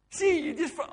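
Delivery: sudden high, loud retold exclamation; triumphant gotcha. Wife catching husband praising God, mock triumphant outburst.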